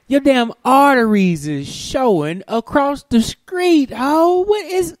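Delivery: high, loud, exasperated; mock yell at the skinny girl; loud disbelief. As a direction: yell it in outrage. Yelling in disbelief.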